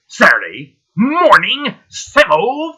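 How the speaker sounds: high, loud, drawn-out sign-off shout; show-title call-out. Acted. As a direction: belt out the show's name, stretching each word. Host calls out show title, signing off.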